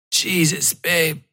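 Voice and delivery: hoarse croak, voice fried by smoke; a light croak, throat raw from smoke. Acted. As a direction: rasp it out through a burnt throat. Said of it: "I croaked".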